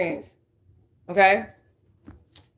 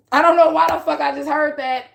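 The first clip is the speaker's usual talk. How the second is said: usual speaking voice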